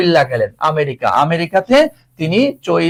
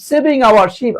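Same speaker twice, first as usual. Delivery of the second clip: raised quick repeat; 6 semitones higher, much slower than usual. Shown as a demonstration. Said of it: "এসে হচ্ছে তোমার শিপও বলা যায়".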